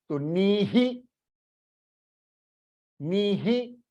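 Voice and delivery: नीहि with a short breathy hi echoing the ee; half hi after ni. Shown as a demonstration. Teacher demonstrating ee-ending visarga. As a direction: say नीहि, the hi only half voiced. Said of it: "तो ही को half करना है".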